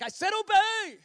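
high, loud mock-angry bellow; angry guy. Angry guy in the corner mad at us, shouting. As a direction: yell it like someone fed up. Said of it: "like this angry guy in the corner that's mostly mad at us".

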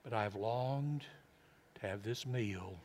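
hushed, heartfelt, with long pauses; quiet, tender. Acted. Preacher voicing Jesus to his disciples at supper, quiet and tender. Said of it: "You know what Jesus said?".

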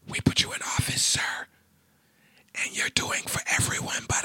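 slow whispered old-man Biden impression; hushed Biden-style whisper, slow pauses; Joe Biden voice. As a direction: whisper to him like a tired old statesman. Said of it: "I'm telling Joe Biden in my Joe Biden voice".

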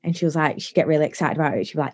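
ordinary talk, nothing marked

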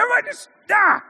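high, loud mock scream of a frazzled parent; a hammed-up hungry parent snapping at the kids. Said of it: "you're like".

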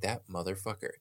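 quiet seething aside; muttered curse. Retold or imitated. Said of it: "I was like".

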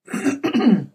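short throat clear. Retold or imitated. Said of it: "I cleared my throat".

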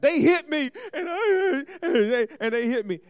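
put-on high, loud whiny child tattling. Pastor mimics a child whining to parents about being hit. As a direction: whine like a kid telling on someone.

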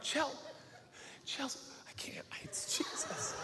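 urgent unvoiced whisper to his wife. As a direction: whisper it so nobody else hears. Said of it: "and I'm like".

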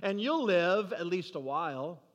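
ordinary delivery

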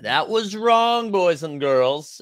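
mock scolding. Real, not acted. Mock-scolding himself to chat.

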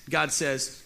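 normal talking voice

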